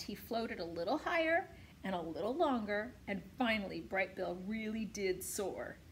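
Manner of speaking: ordinary delivery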